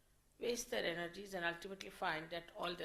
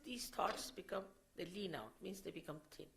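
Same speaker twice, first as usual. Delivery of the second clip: ordinary talk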